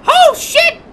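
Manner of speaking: loud, high startled curse; alarmed. Real, not acted. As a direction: blurt it out in sudden alarm. Sudden alarm during gameplay.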